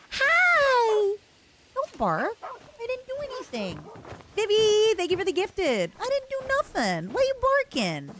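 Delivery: squeaky voice for the dog; high pet voice. Real, not acted. Talking sweetly to a dog.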